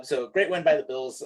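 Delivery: ordinary talk, nothing marked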